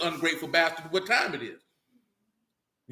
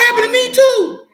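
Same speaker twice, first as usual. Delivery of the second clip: shouted interjection; 11 dB louder than usual. Real, not acted. Someone shouts from off mic.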